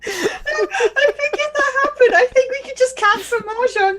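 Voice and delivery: giggling through the words, high and slow; real giggles while talking; laughing. Real, not acted.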